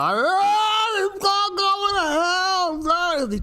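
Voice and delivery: high, slow whiny mock panic; mocking panicked investor. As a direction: mimic a freaked-out investor wailing. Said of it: "people freaking out, going".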